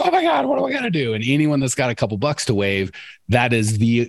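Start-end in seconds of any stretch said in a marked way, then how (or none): 0.0-1.1 hammed-up panicked squeal, much higher; mock-frantic, as if a few dollars were a lifeline. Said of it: "I'm like".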